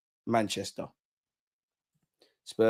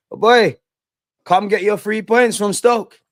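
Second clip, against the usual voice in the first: mocking taunt; 8 semitones higher, 7 dB louder, slower than usual. Real, not acted. Taunting rival fans over Stoke.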